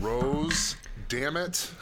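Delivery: loud, high "damn it" at the reveal; exasperated shout. Real, not acted. Player shouting at the villain's name, exasperated. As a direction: yell it out in mock outrage.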